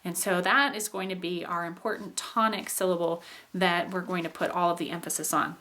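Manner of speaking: ordinary talk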